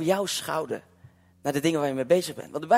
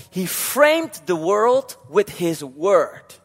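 slow English quote; 3 semitones higher, 6 dB louder, much slower than usual. Shown as a demonstration. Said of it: "In het Engels staat het nog veel mooier".

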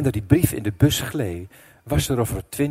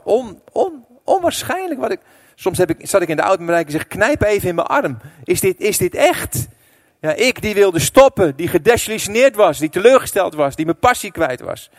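higher, louder, awed climax; preaching at full force; 7 semitones higher, 6 dB louder than usual. Acted. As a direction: marvel at it from the pulpit.

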